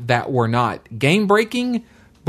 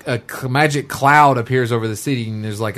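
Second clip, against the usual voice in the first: usual speaking voice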